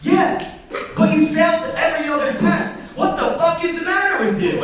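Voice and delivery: high-pitched mock officer outburst; exasperated. Officer to driver who failed every other test, exasperated. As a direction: berate him in acted disbelief.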